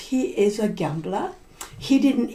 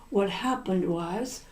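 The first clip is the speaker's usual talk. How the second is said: plain reading voice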